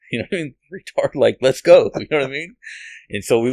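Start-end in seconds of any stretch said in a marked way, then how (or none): 0.7-1.9 mock push. Wife's blunt push, voiced.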